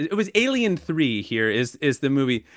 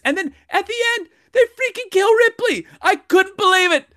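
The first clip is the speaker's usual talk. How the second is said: much higher and louder, exasperated; outraged disbelief; 3 dB louder than usual. Retold or imitated. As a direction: hit the top of your outrage. Peak of retold outrage.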